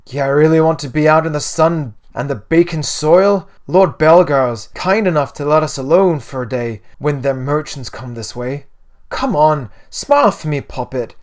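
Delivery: loud, lilting Irish mother; hearty Irish mum cajoling her poppet; weird Irish accent. A narrator's reading. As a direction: coax a child in a put-on Irish accent. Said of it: "a weird Irish accent".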